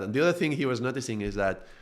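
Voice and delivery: normal talking voice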